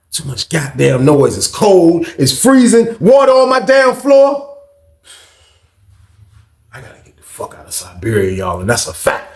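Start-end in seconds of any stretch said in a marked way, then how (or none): 1.4-4.6 building higher into a wail; mounting complaint. Acted. Inmate listing miseries of freezing cell, mounting high complaint. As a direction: escalate from grumble to high protest.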